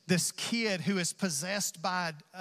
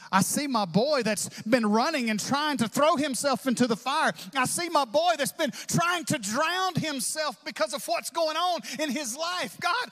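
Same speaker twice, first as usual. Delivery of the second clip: higher, pleading prayer building; desperate prayer; 7 semitones higher than usual. Acted. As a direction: cry out to God for help. Father's desperate prayer voiced.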